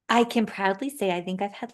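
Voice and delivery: ordinary talk, nothing marked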